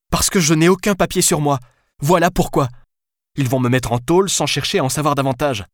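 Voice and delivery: high-pitched, agitated justification; exasperated outburst. Acted. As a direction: fire back each reason in desperation. Desperate woman arguing with her deputy.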